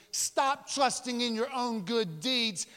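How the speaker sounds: usual speaking voice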